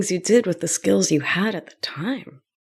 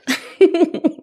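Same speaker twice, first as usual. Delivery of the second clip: short louder high-pitched laugh; 10 semitones higher, 4 dB louder than usual. Real, not acted. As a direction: laugh it off brightly. Laughs at herself.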